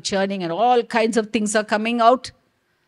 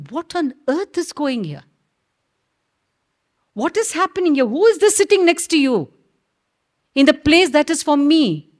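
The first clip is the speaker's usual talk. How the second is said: higher, louder outraged questions; indignant demand; 6 semitones higher, 4 dB louder than usual. Acted. Saraswati confronts Brahma about her seat, indignant and louder. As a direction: confront him, seat taken.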